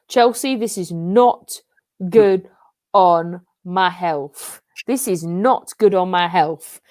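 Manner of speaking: slow, word by word; deliberate, broken-up complaint; stressed emphasis. Real, not acted. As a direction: space out each word for emphasis. Stressed plea to own team.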